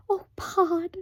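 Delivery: high pleading mother; pleading husky whisper. Acted. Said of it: "pleaded Homily in a husky whisper".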